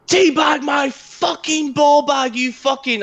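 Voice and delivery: high, slow raging scream at a rival; furious scream. Retold or imitated. Gamer screaming at an opponent, enraged yell. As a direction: scream it at the top of your lungs. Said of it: "screaming it".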